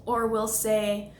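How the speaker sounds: ordinary delivery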